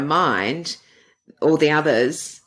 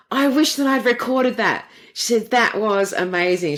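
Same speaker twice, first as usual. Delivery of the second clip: retold amazement, pitched up high; imitating amazement; 7 semitones higher than usual. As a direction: quote the therapist's excited reaction, higher than your own voice. Retells therapist's amazed reaction.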